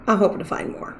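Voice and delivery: soft breathy whisper. Shown as a demonstration. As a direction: whisper it to yourself. Repeated as a whisper.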